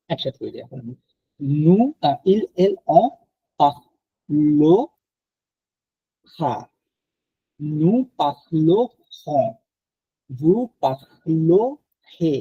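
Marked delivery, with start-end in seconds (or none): 1.6-12.3 পাখলো খা drawn out very slowly with a guttural r; slow teaching demo of পাখলো with uvular r; slow throaty French r. Shown as a demonstration. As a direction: stretch পাখলো খা and scrape the r from the throat. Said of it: "গলার মধ্যে এক এক আসতেছে".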